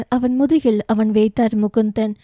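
ordinary delivery

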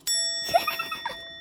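high-pitched, airy burst of laughter; high giggle. Real, not acted. Fan amused by a silly photo, giggling.

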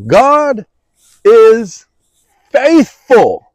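God, is, faithful, each word set apart by pauses. Shown as a demonstration. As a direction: space the words out for weight. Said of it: "how powerful".

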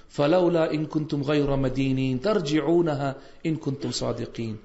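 fast, flowing recitation of a verse; Quran recitation. Acted. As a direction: recite it smoothly, devoutly.

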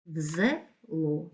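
"В зело" slowly as взе, ло; split into syllables. Shown as a demonstration. Teacher dividing words for pupils, "В зело" syllable by syllable. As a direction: gap inside "зело" before the last syllable. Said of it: "слова на склади".

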